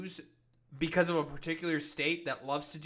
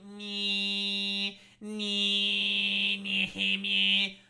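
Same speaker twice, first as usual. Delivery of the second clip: mocking nee nee; 4 semitones higher, 5 dB louder, much slower than usual. Taunting after mock quote.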